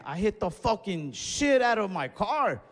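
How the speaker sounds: ordinary talk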